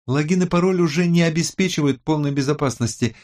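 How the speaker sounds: high-pitched, animated explaining voice; tech expert. A narrator's reading. Tech expert explains anonymity to the team, high and animated.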